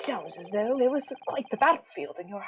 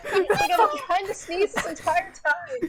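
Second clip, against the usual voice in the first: laughing; 8 semitones higher, 4 dB louder, slower than usual. Real, not acted. Laughing while holding back sneeze.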